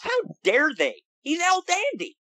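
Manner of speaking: high-pitched, hammed-up indignation; mock outrage. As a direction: play scandalized on behalf of an idol. Mock outrage defending El Dandy.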